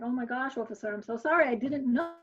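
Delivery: high-pitched, apologetic protest, retold; flustered apology. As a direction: act out pleading innocence to an officer.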